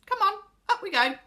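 high, loud cheerful summons; rally the others to climb; rallying call. A narrator's reading. Joe urges everyone to climb.

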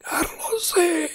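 slow, very high eerie whisper; repulsive dry voice. A narrator's reading. Dark presence calling Carlos, repulsive dry whisper. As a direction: call drily, as if unused to speech. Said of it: "ten odporný, suchý zvuk".

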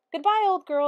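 high, loud cheery goodbye; Danny waving off his sister as he strolls away; hearty farewell. A narrator's reading. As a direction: call it back over your shoulder.

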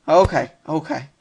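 ordinary talk

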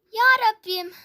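loud pleading cry upward; loud despairing plea; loud exasperated cry. Real, not acted. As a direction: cry out to the heavens in frustration. Gamer exasperated at game.

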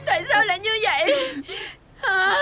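crying in despair. Acted. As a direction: wail helplessly to mother. Daughter despairs to her mother.

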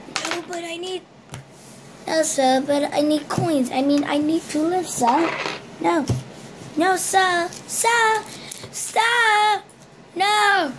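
put-on high pleading British kid voice; poor British kid. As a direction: beg like a poor little British boy. Kid begging for coins, British.

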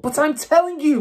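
high, loud defiant shout; girl shouting at the headmistress. A narrator's reading. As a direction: shout and refuse to back down. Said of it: "Matilda shouted".

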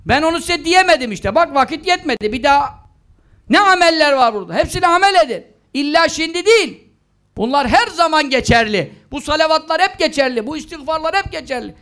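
ordinary talk

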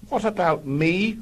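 a raspy whine. A narrator's reading. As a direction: rasp it out, high. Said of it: "rasped Ginger".

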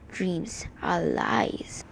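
very slow, hushed, low delivery; villain's whisper. A narrator's reading. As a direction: draw it out softly, almost whispering. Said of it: "Unknown whispered".

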